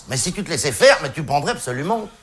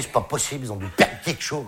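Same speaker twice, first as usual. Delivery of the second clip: inner voice; 4 semitones lower, 6 dB quieter than usual. Retold or imitated. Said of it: "tu te dis".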